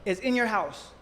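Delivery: ordinary talk, nothing marked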